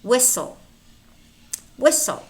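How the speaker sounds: whistle as "wissle"; no T in whistle, just S into L; silent T. Shown as a demonstration. Teacher models silent letters for learners, silent T in whistle. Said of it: "but it's not pronounced".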